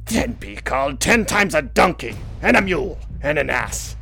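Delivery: excited protest. A narrator's reading. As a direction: protest hotly, building to a shout. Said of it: "then said excitedly".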